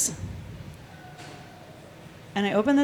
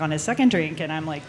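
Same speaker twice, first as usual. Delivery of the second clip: ordinary delivery, nothing marked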